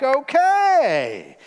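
a scheming mother-in-law catching on; hammed-up matchmaker's "Okay"; knowing mother-in-law. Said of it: "Naomi's like".

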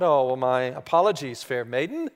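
low, courtly mock apology; dad putting on a gallant royal voice; playing the king. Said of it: "I said".

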